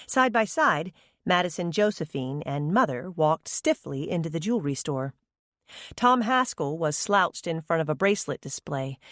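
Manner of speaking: usual speaking voice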